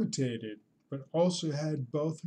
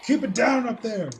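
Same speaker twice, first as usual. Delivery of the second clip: loud, high telling-off; mock parent calling upstairs; 8 semitones higher, 7 dB louder than usual. Parent to noisy kids upstairs, mock yelling. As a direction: holler up to noisy kids in pretend annoyance.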